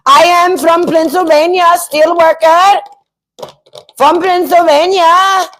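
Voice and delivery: high, slow mock Indian accent; fake Pennsylvanian with foreign accent. Troll pretending to be a Pennsylvania steel worker, mock Indian accent. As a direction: badly pretend to be American in an exaggerated Indian accent. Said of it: "trying to pretend they're from Pennsylvania".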